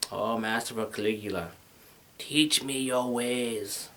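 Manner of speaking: soft, low, slow reverent plea; reverent disciple. Pretend. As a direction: beg humbly like a devoted student. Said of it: "I'd be like".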